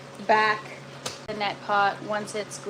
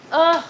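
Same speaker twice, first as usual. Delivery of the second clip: loud frustrated groan; 6 semitones higher, 9 dB louder than usual. Real, not acted. Gardener to live viewers over her broken plant, loud groan. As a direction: let out a big groan of frustration.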